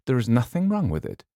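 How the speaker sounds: puzzled insistence, lightly acted; surprised. A narrator's reading. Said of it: "Missus Montague sounded surprised".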